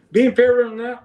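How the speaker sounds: high-pitched scoffing question; retold incredulous echo. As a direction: throw the accusation back, pitched well up. Throws friend's accusation back.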